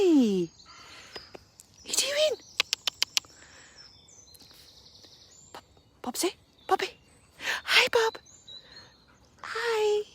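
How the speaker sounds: very high, loud squealing greetings; doggy talk. Real, not acted. Greeting her dogs excitedly.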